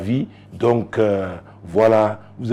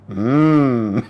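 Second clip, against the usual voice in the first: ordinary delivery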